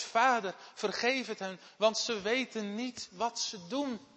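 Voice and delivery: Jesus begging the Father from the cross; loud pleading prayer. Acted. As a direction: cry it out high and loud, slowing at the end. (luid smekend gebed)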